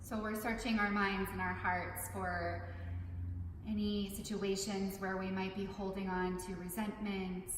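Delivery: calm, measured meditation guide with long pauses; unhurried meditation instruction, spaced out phrase by phrase; guided meditation. Acted. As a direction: lead listeners gently through it, leaving room to breathe.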